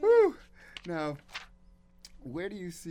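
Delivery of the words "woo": very high, loud whoop; excited whoop. Real, not acted. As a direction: let out a big delighted woo. Host still reacting to the song.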